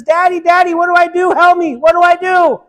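put-on high, loud, pleading little girl; panicked girl. Daughter begging her father what to do, high and panicked. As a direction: cry out, not knowing what to do. Trapped girl crying for help.

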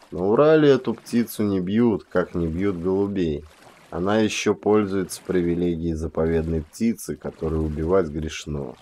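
usual speaking voice

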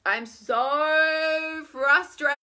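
loud, high, very drawn-out wail; exaggerated exasperated moan; whiny frustration. Shown as a demonstration. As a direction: stretch "so frustrated" into a big whine. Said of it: "say it like that".

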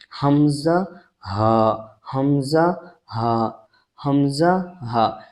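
হামজা হা stretched very slowly, throat sound, no lip push; very slow, hamza then ha from the throat; slow Arabic letter demo; drawn-out Arabic letters. Shown as a demonstration. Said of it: "এর মধ্যে কোনো পেশার চলবে না".

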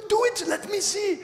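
put-on high, eager accented urging; put-on accent, high and insistent; imitating Reinhard. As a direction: mimic the evangelist egging someone on. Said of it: "or something like that".

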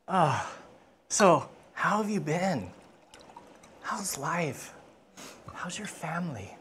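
soft, gentle small talk; hushed, intimate kindness, nearly whispered by the end; caring king. Acted. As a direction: quietly look after a tired guest. King tenderly tends to guest.

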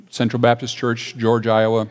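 ordinary delivery

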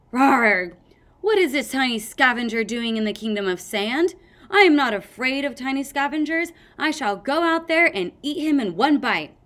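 loud pretend dragon queen threat; mock fierce queen. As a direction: roar and threaten like a monster.

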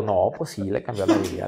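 low, deadpan passenger remark; a hint of a dry male neighbor. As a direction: drop it low and dry, teasing. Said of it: "Y el de al lado". (vecino de asiento, tono grave y burlón)

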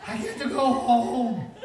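high, loud, hammed-up happy wail; tearful joy. Preacher as teen telling mom he gets home, mock crying. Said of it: "And I was crying".

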